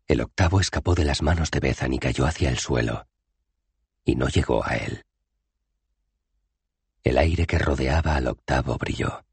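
plain reading voice

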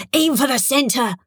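urgent, insistent order; urgent hiss. A narrator's reading. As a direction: urge a soldier on at a critical moment. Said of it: "she hissed urgently".

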